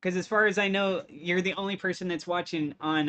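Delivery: ordinary talk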